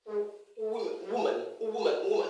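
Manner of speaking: oo-woman, exposing the opening glide; glide pronunciation. Shown as a demonstration. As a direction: slide from oo into woman. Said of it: "there's a little oo sound there".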